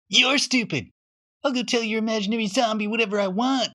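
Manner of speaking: bully brushing Johnny off with a quick sneer. Acted. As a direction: spit it out with a sneer at him. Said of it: "Billy said with a sneer".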